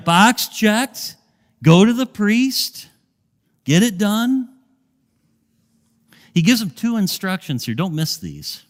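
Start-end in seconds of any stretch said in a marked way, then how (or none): 0.0-4.5 higher and louder, bouncing with fun; playful emphasis. Pretend. Preacher joking with the church, higher and louder. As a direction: tease the crowd, pitched up and punchy. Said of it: "just having a little fun".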